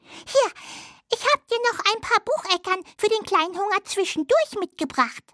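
high little mouse voice; little hazel dormouse. Acted. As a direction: play a tiny friendly mouse, pitched high. (hohe Stimme einer kleinen Haselmaus)

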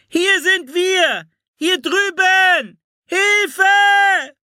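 put-on very high, drawn-out child's yell; shouting for help. A narrator's reading. Anne shouts to the approaching light, long high calls. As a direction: holler slowly to carry far.

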